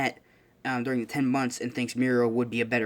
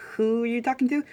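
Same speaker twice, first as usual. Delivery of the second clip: high-pitched bewilderment; baffled question; 11 semitones higher than usual. Acted. Classmates seeing nobody there, baffled. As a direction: ask it high, mystified.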